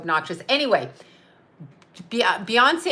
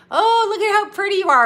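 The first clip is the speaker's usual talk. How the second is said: high, loud sugary mock of her mother; high, loud mock of a catty mother's praise; mocking mom; 11 semitones higher, 5 dB louder than usual. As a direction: sing it out like a fake-sweet mother. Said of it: "my mom was terrible that way".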